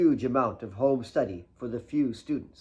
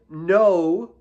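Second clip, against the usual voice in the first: high, loud little no; firm girlish no; 6 semitones higher, 10 dB louder than usual. Acted. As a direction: stop him playfully. Kate stops him with pencil.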